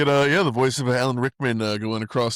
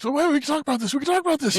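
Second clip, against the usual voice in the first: much higher, squeaky mock-panicked pleading; panicky pleading; much faster than usual. Matt Damon's character pleading not to be thrown off, mock-panicked high squeak. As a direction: beg your way out, hammed-up. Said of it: "Matthew's like".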